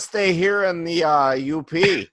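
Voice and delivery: put-on a jail inmate declining escape in a Minnesota accent. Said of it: "Is this the Netherlands or Minnesota?".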